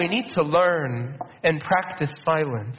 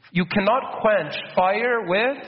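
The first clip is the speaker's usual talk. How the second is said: ordinary talk, nothing marked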